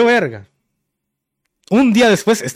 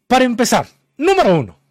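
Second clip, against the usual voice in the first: raised pitch, brisk emphatic imitation; retold frazzled clerk; 6 semitones higher than usual. Clerk answering a customer, in mock agitation. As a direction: imitate a worked-up clerk laying down points. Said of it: "así toda juida".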